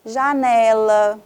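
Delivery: Janela stretched long on NE, loud; drawn-out stressed syllable. Shown as a demonstration. Teacher showing pupils the tonic syllable, Janela with a long, loud NE. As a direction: call out Janela, dragging the stressed NE.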